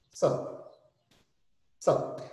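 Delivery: "Sup" as one tiny syllable; very clipped. Retold or imitated. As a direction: cut "what's up" down to a quick "Sup". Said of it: "Porque ela fala muito rápido".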